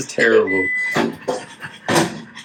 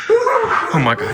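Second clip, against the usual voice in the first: high shrieking laugh through words; 14 semitones higher than usual. Real, not acted. Laughing shout at prank.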